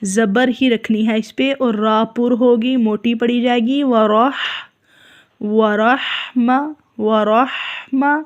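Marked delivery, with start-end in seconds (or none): 5.4-8.2 slow ورحمہ with a full a after ra; ورحمہ, ra open and heavy; slow warahma. Shown as a demonstration. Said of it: "رے کو زیر کی طرف مائل نہیں کرنا". (ورحمہ میں را پر)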